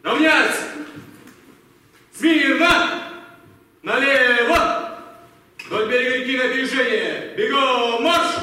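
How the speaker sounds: loud barked parade-ground orders; shouted drill commands. Acted. Captain shouting orders to his squad, loud barking. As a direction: bark each order across the field.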